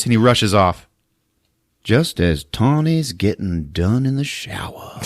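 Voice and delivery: ordinary delivery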